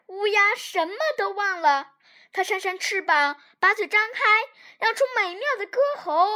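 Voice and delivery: ordinary delivery, nothing marked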